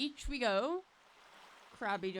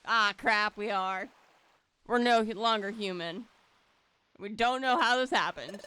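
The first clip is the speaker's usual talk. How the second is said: ordinary talk, nothing marked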